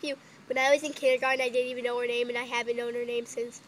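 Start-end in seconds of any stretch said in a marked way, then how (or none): none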